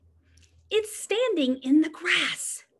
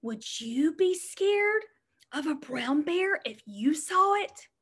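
ordinary delivery

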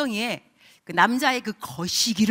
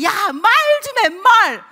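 high, loud and slow, pressing for an answer; loud demanding call; 10 semitones higher, 11 dB louder, much slower than usual. Pretend. The Lord chasing the believer, loud urging call. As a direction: call out high and loud, slow, like a friend who won't give up.

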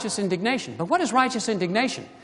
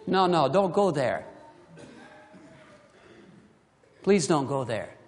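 softer, lower plea; gentle warning; 7 semitones lower, 5 dB quieter than usual. Acted. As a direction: plead quietly with them not to go there. Pleading warning to congregation.

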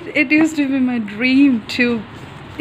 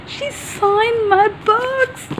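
high, slow, drawn-out squeal; real overjoyed disbelief; 8 semitones higher, slower than usual. Real, not acted. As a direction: stretch it out high in sheer joy. Overjoyed at signed books.

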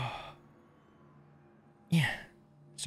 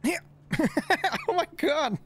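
excited squeal. Real, not acted. Triumphant in-game success.

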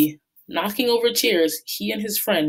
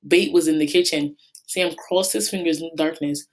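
ordinary delivery, nothing marked

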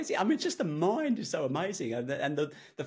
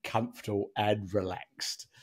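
hypnotic voice; 6 semitones lower, 3 dB quieter than usual. Acted. Hypnotist puts subject to sleep.

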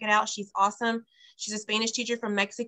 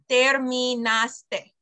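very slow, syllable by syllable; slow, careful; 4 dB louder, much slower than usual. Shown as a demonstration. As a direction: draw the word out for learners. Slow teaching model of word.

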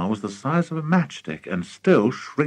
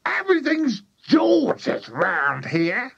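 high, slow, indignant granny; high-pitched grumbling old woman; shouting Grandma; 14 semitones higher, slower than usual. A narrator's reading. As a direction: complain in a drawn-out high granny screech. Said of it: "shouted Grandma".

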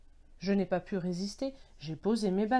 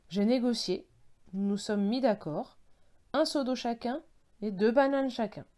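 plain reading voice

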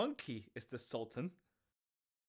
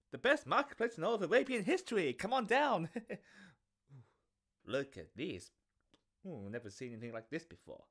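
lively, sing-song market peddler; street peddler voice; 3 semitones lower than usual. Acted. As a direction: hawk wares like a grinning merchant. Peddler hawking wares.

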